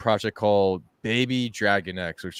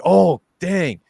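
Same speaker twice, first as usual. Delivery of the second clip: higher, louder, hyped-up exclamation; excited reaction; 11 semitones higher, 8 dB louder than usual. Retold or imitated. Host retelling his surprise at seeing the launch, excited. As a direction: act out being thrilled by sudden news.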